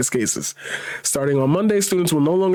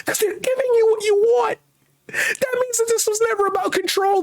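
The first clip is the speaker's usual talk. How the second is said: high-pitched exasperated rant; mad; 11 semitones higher than usual. Real, not acted. Host telling protesters it was never about control, mad. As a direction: push the outrage up into a very high, strained pitch. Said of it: "Now I'm just mad".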